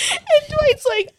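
ordinary talk, nothing marked

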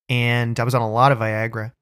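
ordinary talk, nothing marked

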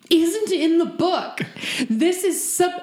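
high, emphatic mock outrage; exasperated protest. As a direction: spell it out slowly, worked up. Replaying her outraged protest to Tom.

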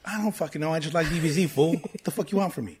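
mock the kid who just likes DBZ, fool; annoyed tough kid. Friend acting out a prickly classmate, hammed-up tough-guy voice.

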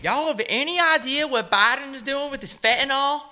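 high, loud Southern caricature; put-on Southern accent. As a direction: squawk it in a cartoon Southern accent. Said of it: "I love doing the Southern accent".

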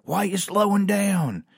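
high mock coach yell. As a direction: needle the runners like the lazy coach. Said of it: "he would yell out".

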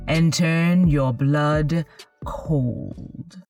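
slow, ominous storyteller intro; horror host. Acted. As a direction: draw them in slow and eerie.

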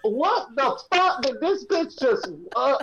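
high, slow disbelief; mock outraged disbelief; incredulous. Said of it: "my face is like".

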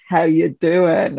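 attempted American accent in a slow pickup line; slow, halting try at Joey's American accent. British host trying a sitcom flirt, American accent attempt. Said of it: "Joey from Friends".

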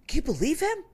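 high indignant animal voice; scandalized storybook chicken; chicken outraged. Retold or imitated. Chicken complaining to wombat about the fox, high indignant voice. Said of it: "he says".

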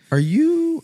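squeaky high "Are you?"; high surprised question. Retold or imitated. Cart girl asking the golfer, high and amazed. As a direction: pitch it way up in disbelief.

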